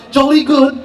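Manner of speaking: very high, plummy mock-British survivor; mock British accent. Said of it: "excuse the accent".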